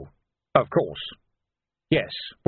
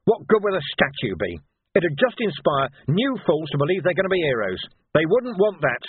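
impassioned, higher-pitched tirade; heated speech slowing to an emphatic close; angry outburst; 7 semitones higher, 4 dB louder than usual. A narrator's reading. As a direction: rail indignantly, punching the key words. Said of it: "as if there had been no outburst".

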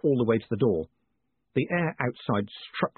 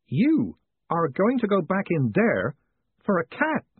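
incredulous; 8 semitones higher, slower than usual. A narrator's reading. Dearheart incredulous.

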